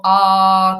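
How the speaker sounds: drawn-out "a", tongue kept down; vowel demo. Shown as a demonstration. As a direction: hold an open "a" on one steady note. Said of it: "que tengo la lengua abajo".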